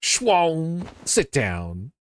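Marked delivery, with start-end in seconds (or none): 0.0-0.9 higher, louder vocal sound effect; playful imitated kick noise; mock kick whoosh. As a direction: swoosh it like a cartoon hit. Kick sound during attack.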